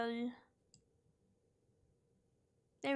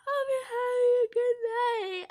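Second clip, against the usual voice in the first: high, stretched-out words through a big yawn; greeting swallowed up by a yawn; 11 semitones higher than usual. Real, not acted. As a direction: talk right through a yawn. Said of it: "Big yawn".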